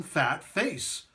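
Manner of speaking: usual speaking voice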